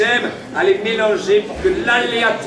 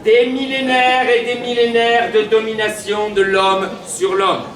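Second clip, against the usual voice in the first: town crier. Acted. Proclaiming to crowd.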